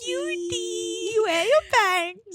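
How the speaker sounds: put-on high, loud baby talk. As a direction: coo it like a toddler. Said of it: "I'm talking like a baby".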